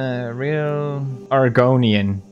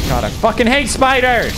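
high cry of loathing; exasperated outburst; 8 semitones higher than usual. Real, not acted. Player attacked by spiders in game, exasperated outburst. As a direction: burst out higher in disgust.

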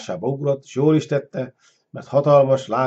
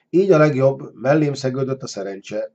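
usual speaking voice

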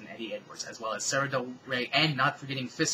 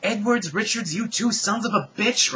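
high, loud outraged yell; angry shout; 9 semitones higher, 10 dB louder than usual. Acted. Tricked Icarus yells at Edwards and Richards, angry shout. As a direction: bellow it at the two culprits.

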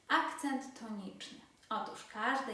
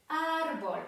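Árbol with a raised, forceful first syllable; penultimate stress; 5 semitones higher, 6 dB louder than usual. Shown as a demonstration. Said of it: "akcent właśnie pada na".

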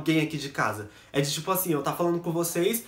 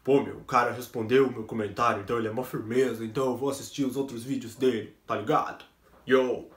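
put-on lower, laid-back street-kid voice; imitating a fan; 6 semitones lower than usual. Voicing a viewer who got a reply, lower and laid-back. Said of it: "ela pensa assim".

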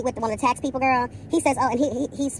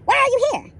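"Why ARE you here?" jumps way higher and louder, ARE hit hard; shrill mock outrage; 12 semitones higher, 4 dB louder than usual. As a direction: squeal "are" high and sharp in mock disbelief. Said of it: "I read her for filth".

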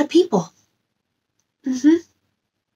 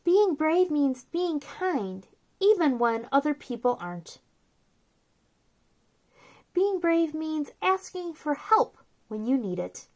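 soft, gentle read-aloud of a picture book; storybook reading; 3 semitones higher, 10 dB quieter than usual. Acted. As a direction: read it softly to little kids, like a bedtime book.

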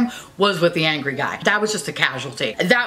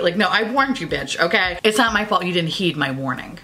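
ordinary talk, nothing marked

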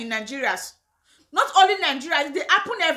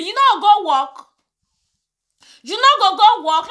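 louder, higher, telling them off; loud indignant scold; 4 semitones higher, 7 dB louder than usual. Real, not acted. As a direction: bark it at the lazy brother-in-law. Scolding the in-laws directly.

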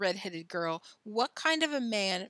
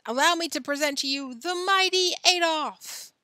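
loud, grand ringmaster's announcement; circus ringmaster; 4 semitones higher, 6 dB louder than usual. A narrator's reading. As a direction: proclaim the star act to the whole tent. Ringmaster introduces the strongman to the crowd.

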